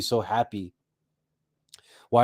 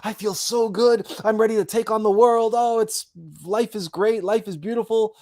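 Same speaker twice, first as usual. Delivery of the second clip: higher, sing-song mock cheer; overly happy; 7 semitones higher than usual. Mocking the answer people expect, hammed-up bubbly cheer. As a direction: gush like you're on cloud nine. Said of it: "they're expecting you to say".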